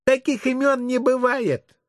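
put-on high little-kid voice; cheeky child. Child challenging the visiting sergeant's name, high kid voice. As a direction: pipe up high like a doubting kid.

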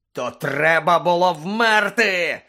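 loud, drawn-out bellow; thundering outrage; furious roar. Acted. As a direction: roar it slowly, full of rage. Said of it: "заревів Блек".

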